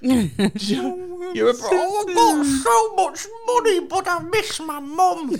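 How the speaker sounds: high, slow whiny mockery; mock whiny Bruce Wayne. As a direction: whine it like a spoilt rich boy.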